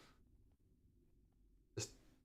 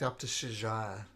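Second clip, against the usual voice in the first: ordinary talk, nothing marked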